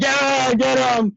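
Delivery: rooting against him; crowd cheering. Retold or imitated. Crowd cheering when he gets hit.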